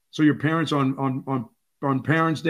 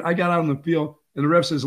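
normal talking voice

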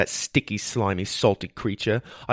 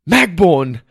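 alarmed high, loud cry of a name; 7 semitones higher, 9 dB louder than usual. A narrator's reading. Boy to collapsed healer, crying out. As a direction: call out loudly to a collapsed friend. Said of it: "I cried".

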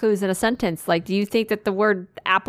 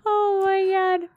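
much higher, gleeful; high delighted exclaim; 12 semitones higher than usual. Real, not acted. Remembering the prank, delighted high exclaim. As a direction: squeal it out in delight.

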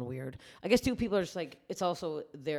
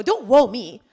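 loud, higher indignant retort; retold angry comeback; 6 semitones higher, 14 dB louder, slower than usual. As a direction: fire back in a re-enacted quarrel.

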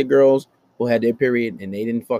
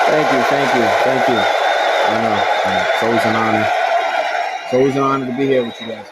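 raised voice over the applause; louder, pretend-humble stage thanks; mock acceptance speech; 5 dB louder than usual. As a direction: thank an adoring crowd, playing it up. Acknowledging a staged crowd's applause.

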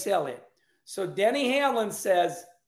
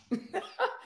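high laugh; 11 semitones higher, 6 dB quieter than usual. Real, not acted. Laugh alone.

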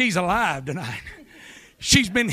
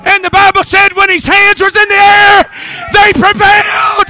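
rising, shouted revival preaching; preaching at full force; 11 dB louder than usual. Acted. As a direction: drive the congregation with a loud, building sermon.

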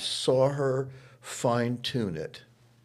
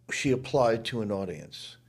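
normal talking voice

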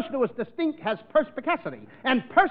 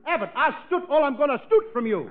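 usual speaking voice

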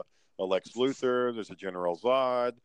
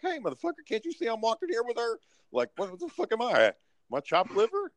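indignant outburst; 11 semitones higher than usual. Retold or imitated. Retold outburst at man hitting on friend.

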